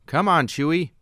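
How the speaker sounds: lightly voiced brash smuggler urging his friend; Han Solo voice. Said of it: "Han told his Wookiee co-pilot".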